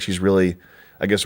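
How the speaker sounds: ordinary delivery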